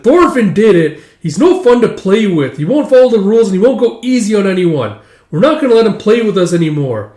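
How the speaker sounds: put-on high, loud kid complaint; loud playground accusation; indignant tattling. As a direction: tell on him like an aggrieved child. Child tattles on Thorfinn.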